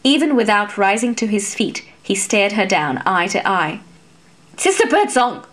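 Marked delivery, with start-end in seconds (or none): 4.6-5.4 higher, sharp scolding call; rebuke. A narrator's reading. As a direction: stop her with a scolding cry. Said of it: "Laéron rebuked her".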